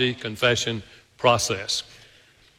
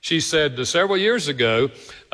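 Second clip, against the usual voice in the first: ordinary delivery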